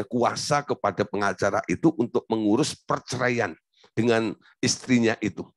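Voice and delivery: usual speaking voice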